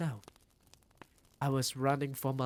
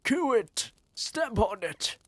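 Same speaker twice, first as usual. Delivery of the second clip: high, loud angry yells; shouting; 9 semitones higher, 7 dB louder than usual. A narrator's reading. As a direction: roar it like a mob. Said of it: "they were shouting".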